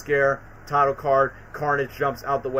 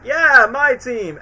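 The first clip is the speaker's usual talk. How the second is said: higher, louder, slow cheer; 8 semitones higher, 7 dB louder, much slower than usual. Retold or imitated. Spider-Man cheering his robots, high and loud. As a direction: savour it like a proud fan. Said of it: "Ultimate Spidey's like".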